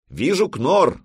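high, loud sighting call; lookout's shout. A narrator's reading. Lookout alerts the vikings to a ship, shouting. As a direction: shout it out as an alarm. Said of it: "Торфи закричал".

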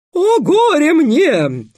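very high, slow, cracking wail; breaking falsetto. Acted. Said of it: "срывающимся фальцетом воскликнул Песя". (срывающийся фальцет)

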